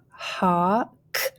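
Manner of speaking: "hawk" stretched as haah, rising, then a separate k; slow blended "hawk"; blending. Shown as a demonstration. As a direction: draw out "hawk" before the final k. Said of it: "start blending".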